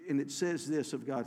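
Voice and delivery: usual speaking voice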